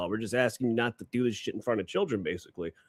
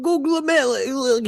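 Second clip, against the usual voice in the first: high, loud, stretched Italian caricature; mock Italian accent; 8 dB louder, much slower than usual. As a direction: belt the name out like a hammed-up Italian. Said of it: "It sounds Italian".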